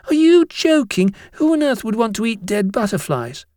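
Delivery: high-pitched scoffing schoolboy; incredulous. A narrator's reading. Binns doubts the butterflies were eaten, incredulous high voice. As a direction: question it in utter disbelief. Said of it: "Binns was incredulous".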